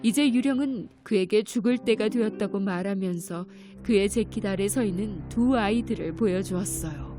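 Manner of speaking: ordinary delivery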